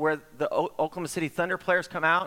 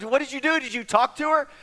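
eager questions; 10 semitones higher, 3 dB louder than usual. Retold or imitated.

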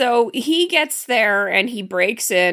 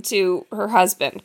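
ordinary talk, nothing marked

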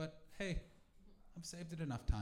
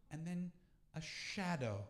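ordinary talk

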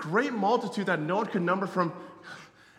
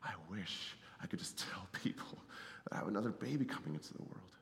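soft whisper, no voice; hushed retold wish; 10 semitones lower, 14 dB quieter than usual. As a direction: whisper a secret you long to tell. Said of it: "I was like".

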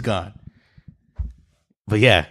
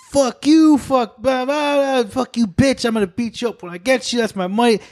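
much higher mock rant; imitating a ranting stranger; 12 semitones higher than usual. Friend mimics a screaming woman on the street, high hammed-up rant. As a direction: ham up a woman yelling at nobody. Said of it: "causing this ruckus".

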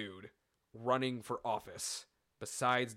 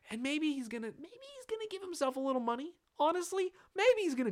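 high-pitched concession; faster than usual. Real, not acted. Podcaster grudgingly allows for Trump's greed, high-pitched hedging. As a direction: voice shoots way up high.

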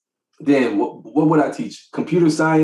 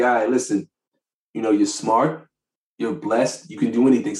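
calming talk to daughter; 5 semitones lower, 4 dB quieter than usual. Retold or imitated.

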